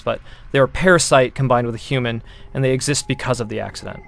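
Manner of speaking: ordinary delivery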